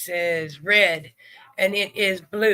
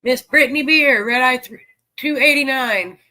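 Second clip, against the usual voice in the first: loud, higher announcing call; 4 semitones higher, 7 dB louder than usual. Real, not acted. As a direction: call it out to the room. Calling out bingo numbers.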